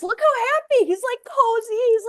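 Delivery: very high-pitched cooing delight; delighted gushing. Real, not acted. Co-host shows off her happy AI, delighted gushing. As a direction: gush over something adorable. Gushing over cute image.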